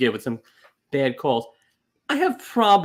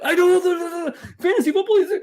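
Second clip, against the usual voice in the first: much higher, louder, frantic babble; frantic overexcited rant; 12 semitones higher, 3 dB louder, faster than usual. Retold or imitated. Retelling his frantic reply to the coworker, overexcited. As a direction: go from zero to ten thousand at once. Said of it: "you just did literally Uncle Vito".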